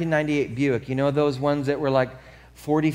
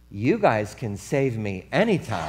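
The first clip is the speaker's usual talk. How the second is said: lower, laughing, flirty tease; flirty line; 7 semitones lower, 3 dB quieter than usual. Retold or imitated. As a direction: flirt with the paramedics. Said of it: "and she goes".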